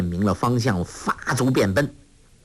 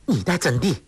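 stern, higher-pitched demand; stern challenge; 4 semitones higher, faster than usual. A narrator's reading. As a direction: confront them sternly. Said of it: "凛然道". (凛然质问)

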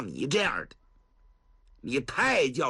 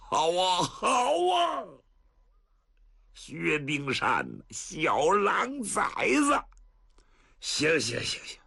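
choked, halting fury; so angry the words won't come; breathless rage; 4 semitones higher than usual. Acted. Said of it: "老程都上不来气儿了". (气得喘不上气)

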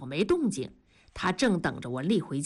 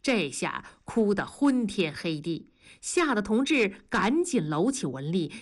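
ordinary delivery

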